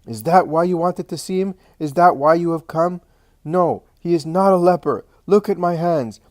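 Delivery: high, agitated indignant protest; worked-up, pitched high throughout; convulsive shouting. A narrator's reading. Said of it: "shouts Martha convulsively".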